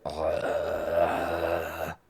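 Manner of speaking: low guttural groan; zombie moan. Acted. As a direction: groan like the undead. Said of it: "That's the sound I just made".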